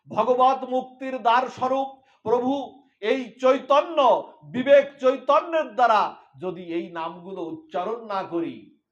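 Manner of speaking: high, loud impassioned warning; fervent preaching. Acted. Preacher warning listeners not to waste this birth. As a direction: rouse the gathering with urgency.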